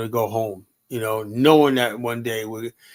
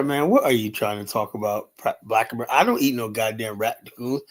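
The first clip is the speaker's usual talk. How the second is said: ordinary talk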